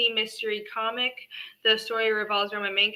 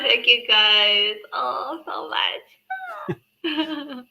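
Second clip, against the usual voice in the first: tearful; 4 semitones higher, 3 dB louder, much slower than usual. Real, not acted. Moved by backers.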